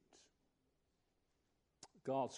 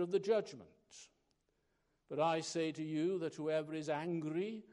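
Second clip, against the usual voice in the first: loud, ringing scripture reading; sermon voice declaiming Matthew five at full volume; preaching scripture; 3 semitones higher, 9 dB louder than usual. Acted. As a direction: proclaim the Lord's words to the congregation. Reads Jesus' words with force.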